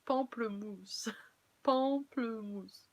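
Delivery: pamplemousse said slowly, pahn-pluh-MOOSS; French word, slowly. Shown as a demonstration. Correcting a viewer's word, slow French pamplemousse. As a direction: spell out pamplemousse syllable by syllable, French. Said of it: "it's not pomelo".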